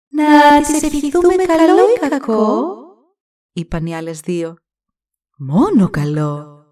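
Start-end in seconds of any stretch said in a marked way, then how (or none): 0.1-2.7 high, loud, slow otherworldly voice; fate goddesses asking. Acted. Two goddesses ask together. 5.4-6.6 slow, deliberate pronouncement; kind goddess. Acted. First goddess decides.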